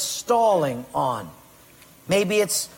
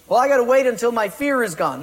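mock excuse; 6 semitones higher, 5 dB louder, faster than usual. Congregant's excuse voiced.

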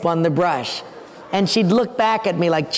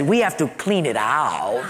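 put-on German accent; 6 semitones lower than usual. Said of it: "had this real heavy German accent".

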